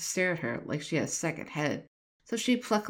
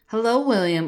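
raised voice over the roar; calling out; 5 semitones higher, 7 dB louder than usual. A narrator's reading. Eliza calling to William over roaring fire, raised voice. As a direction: call out to be heard over the fire.

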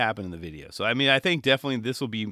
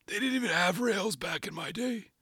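put-on old man voice; 8 semitones higher, 5 dB quieter than usual. Voicing the old gentleman.

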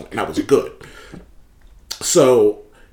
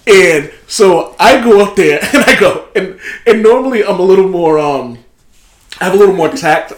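laughing; 6 semitones higher, 7 dB louder than usual. Real, not acted. Laughing while telling story.

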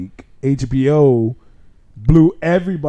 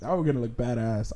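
usual speaking voice

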